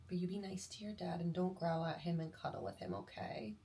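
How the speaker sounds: soft tender talk to the dog; tender soft goodbye. Real, not acted. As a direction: gently reassure the pet she'll be back.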